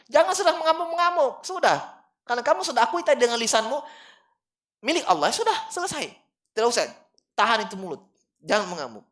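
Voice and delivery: high, loud fiery sermon voice; preaching at full force. Acted. Preacher scolding mourners who rage at a death, loud and high.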